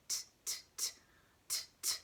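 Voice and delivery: crisp voiceless T ticks; short, separate tongue-tip clicks; ticking watch. Shown as a demonstration. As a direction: tick evenly like a watch. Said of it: "Sounds like a watch".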